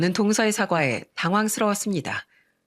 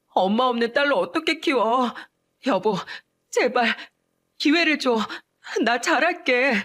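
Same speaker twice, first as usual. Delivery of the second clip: desperate begging; 4 semitones higher than usual. Acted. Wife begging husband for one more chance, desperate. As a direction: beg like she might lose her child. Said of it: "애원하는 동서".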